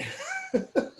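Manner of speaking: high giggle; light giggle. Real, not acted. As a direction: giggle softly. Laugh after handing over the floor.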